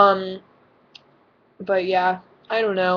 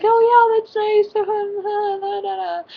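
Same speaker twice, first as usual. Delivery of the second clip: very high, loud, squeaky "Oh, yeah"; nervous voice jumping way up; voice goes high; 14 semitones higher, 5 dB louder, much slower than usual. Retold or imitated. Told her voice rises when nervous, answering in a squeaky high voice. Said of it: "my voice gets higher".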